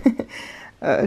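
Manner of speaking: ordinary delivery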